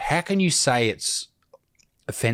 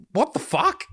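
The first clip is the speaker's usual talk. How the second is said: incredulous outburst; 12 semitones higher than usual. Real, not acted. Reacting to the aggression claim.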